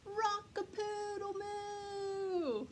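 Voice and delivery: high, slow drawn-out moo-call; cow mooing a crow. Acted. Cow bellowing its attempt at cock-a-doodle-doo. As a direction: stretch it out like a big cow trying to crow.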